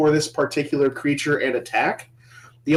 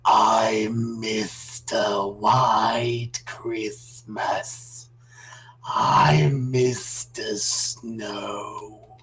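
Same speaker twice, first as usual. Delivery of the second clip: slow, low creepy hissing snake singer; lispy snake voice; 8 semitones lower, 7 dB quieter, much slower than usual. Acted. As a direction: sing it slowly like a creepy snake. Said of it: "In a slightly creepy, lispy snake voice".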